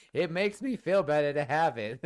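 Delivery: high, loud cartoon clown droid; John Mulaney. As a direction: justify it as a hammy comedian droid. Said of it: "I made John Mulaney sound".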